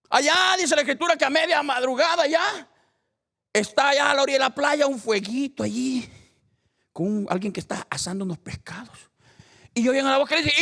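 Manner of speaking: high, loud sermon storytelling; loud, high-pitched scripture retelling; full-force preaching. Acted. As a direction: tell it like a fired-up preacher.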